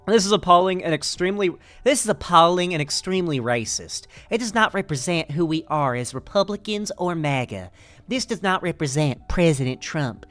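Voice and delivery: lightly voiced indignant congresswoman; imitating Marjorie Taylor Greene. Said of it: "So even Marjorie Taylor Greene was like".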